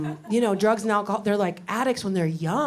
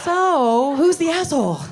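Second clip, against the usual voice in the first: raised, higher indignant jab; mock outraged shout; 5 semitones higher than usual. Wife confronting husband about cheap ice cream, mock outraged shout.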